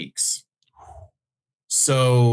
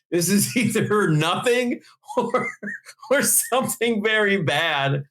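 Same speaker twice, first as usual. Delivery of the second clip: retold thought, higher and slow, broken by laughs; nervous laughing; 9 semitones higher, slower than usual. Employee retelling his wait for layoff news, laughing nervously. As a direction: draw it out high, laughing through the bad news.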